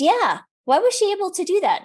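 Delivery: high, quick demanding question; retold pointed disbelief; indignant mother. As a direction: challenge the doctor as an upset mom.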